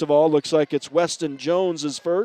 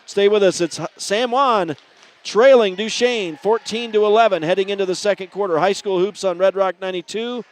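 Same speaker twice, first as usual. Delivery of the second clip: projected, higher-pitched sportscast wrap-up; announcer's break sign-off; 3 semitones higher, 3 dB louder than usual. Real, not acted. Announcer closing the first quarter, upbeat radio sign-off. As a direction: sum up the quarter and throw to break.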